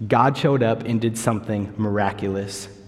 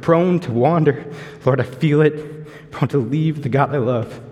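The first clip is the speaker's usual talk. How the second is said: heartfelt; 2 semitones higher than usual. Real, not acted. Quotes favorite line passionately.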